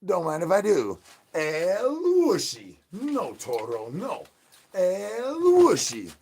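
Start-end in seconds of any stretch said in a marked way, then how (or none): 1.3-2.8 a long, high, sing-song drawl; drawn-out high call. Acted. Streamer calling out his catchphrase in a slow high drawl. 4.7-6.1 very slow and high, stretched out; drawn-out high call. Acted. Streamer calling out his catchphrase in a slow high drawl.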